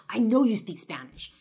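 loud, accusing outburst; bursting out angrily. Retold or imitated. Stranger accusing the young girl of hiding her Spanish, loud outburst. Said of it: "finally he bursts out".

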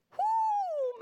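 loud, very high "whoo" of exasperation; high-pitched whoo. Real, not acted. Mom reacting to her own guilt, high whoo. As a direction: let out a long, soaring whoop.

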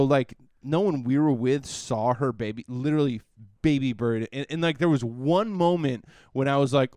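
ordinary talk, nothing marked

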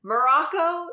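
excited. Real, not acted. Gleeful about World Cup upsets.

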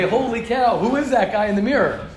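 hammed-up astonishment; mock freak-out. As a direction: act startled by a stranger in the mirror. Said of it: "You'd freak out, you'd say".

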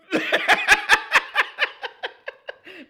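high, loud, teary burst of laughter; real choked-up laugh; emotional laugh. Real, not acted. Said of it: "I'm a little emotional".

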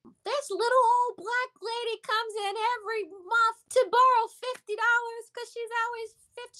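high-pitched imitation of a laughing girl; mocking friend. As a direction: mimic the friend telling her cruel joke. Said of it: "the girl just laughs".

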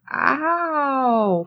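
high, drawn-out imitated "ow"; playful cry of pain; mock hurt "ow". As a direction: whine it like a bonked victim. Imitates character hit on head.

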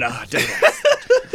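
laughing mock British groan. Friend imagines a British ringtone answering how are you, high laughing British. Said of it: "I could just play a British voice going".

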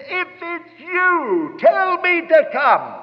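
high, slow, drawn-out call. Acted. Peter asking Jesus from the boat, calling out slowly. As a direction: stretch each word to carry over the waves.

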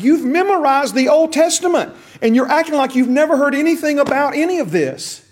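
high, loud incredulous rebuke; a preacher voicing Jesus' incredulous comeback; exasperated retort. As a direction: throw it back at him in disbelief. Jesus retorting to Nicodemus, paraphrased.

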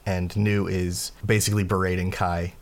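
normal talking voice

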